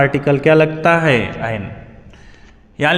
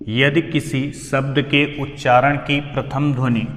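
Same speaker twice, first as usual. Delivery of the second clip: word-by-word dictation pace; 2 semitones lower, 7 dB quieter than usual. Acted. Teacher dictates rule for writing.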